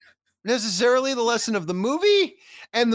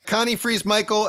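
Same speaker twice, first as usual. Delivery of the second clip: usual speaking voice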